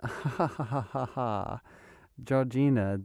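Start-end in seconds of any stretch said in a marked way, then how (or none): 0.0-1.6 spelled-out mock laugh, softer; a polite, hammed-up "ha ha ha"; fake laugh. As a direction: laugh on purpose, not for real. Forced laugh at the shop's name.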